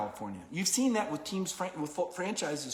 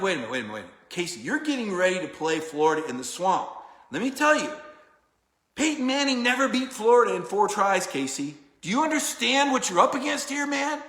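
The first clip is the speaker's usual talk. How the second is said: louder, pressing warning to a friend; retold urgent warning; 4 semitones higher, 6 dB louder than usual. Retelling how he warned the quarterback, loud and insistent. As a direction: lean in hard, wait a minute.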